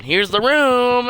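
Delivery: slow, high, loud tour-guide intro; cheery announcement. Pretend. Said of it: "a five-second video just saying".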